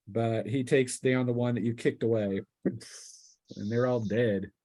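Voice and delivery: ordinary talk, nothing marked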